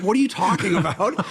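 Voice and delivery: mock baffled, half laughing; incredulous. Austrian actor answering casting people, laughing incredulously.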